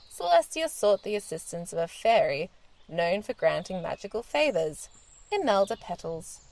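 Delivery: plain reading voice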